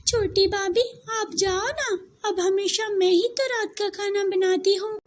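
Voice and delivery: put-on high, tearful girlish plea; a girl near crying begging her not to bother. Girl pleads, near tears. (रोआँसी विनती)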